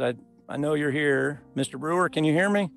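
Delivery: ordinary delivery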